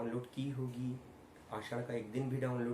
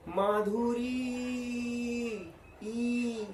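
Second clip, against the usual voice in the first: माधुरी with री held long and high, then ई alone; stress on ई; 9 semitones higher, 4 dB louder, much slower than usual. Shown as a demonstration. Teacher isolating the vowel, Madhuri then a long high ई. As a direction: sustain the ई of Madhuri, loud and high. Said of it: "ई पर stress गया".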